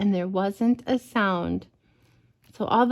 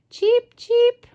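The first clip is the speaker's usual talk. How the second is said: very high, louder cheep cheep; sparrow chirp imitation; 13 semitones higher, 5 dB louder than usual. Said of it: "I'm not very good at bird sounds".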